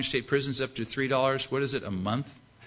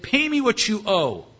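higher-pitched forceful demand; mock-angry creditor demanding payment; harsh demand; 8 semitones higher, 4 dB louder than usual. Servant seizing his debtor by the throat, demanding.

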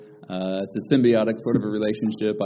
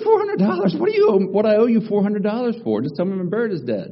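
high, loud indignant squawk; outraged disbelief; 11 semitones higher, 5 dB louder than usual. Retold or imitated. Customer shocked by the vet's bill, high indignant outcry. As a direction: shriek the price back at him in shock.

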